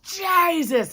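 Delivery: high, drawn-out burst of a cry; last-breath cry. Retold or imitated. Woman breaking free of paralysis, crying out high. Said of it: "And that last breath".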